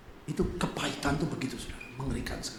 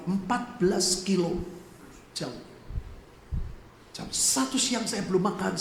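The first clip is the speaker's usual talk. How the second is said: normal talking voice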